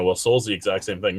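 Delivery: ordinary delivery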